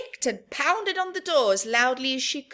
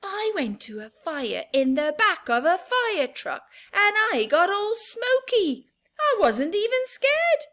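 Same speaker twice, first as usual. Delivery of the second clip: put-on proud kid boasting; 9 semitones higher, 4 dB louder than usual. Sheila proud.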